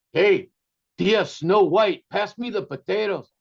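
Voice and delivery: mock tough nephew calling out; imitating nephew. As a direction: holler it like a rough cousin at dinner. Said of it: "he leans over and he goes".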